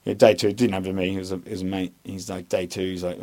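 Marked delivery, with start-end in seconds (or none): none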